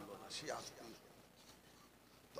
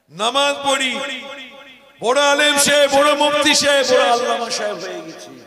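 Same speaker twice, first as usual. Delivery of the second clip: high, very loud declamation; soaring sermon cry, easing at the end; full-force preaching; 12 semitones higher, 25 dB louder than usual. Real, not acted. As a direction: ring out each title to the crowd.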